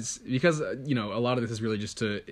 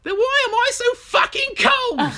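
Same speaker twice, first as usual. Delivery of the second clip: loud, slow, very high English cub wail; English baby polar bear; 8 dB louder, slower than usual. Acted. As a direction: shriek the punchline as an aggrieved English cub. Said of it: "It lets me do a horrific accent".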